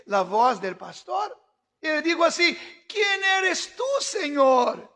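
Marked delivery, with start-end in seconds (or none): 2.9-4.9 awed cry. Acted. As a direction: call out to the unseen Lord, startled. Pablo clama al Señor. (Pablo clamando asombrado al Señor)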